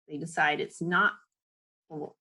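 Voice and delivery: ordinary delivery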